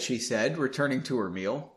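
ordinary talk

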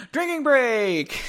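high, drawn-out singsong call; gleeful announcement. Real, not acted. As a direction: call it out with a real laugh behind it. Announces drinking break, laughing.

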